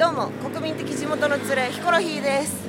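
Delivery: high, loud retro anime promo voice; Showa anime announcer. Acted. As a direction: hype it like an old cartoon trailer. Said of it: "なんでその昭和のアニメみたいな番宣できるんですか".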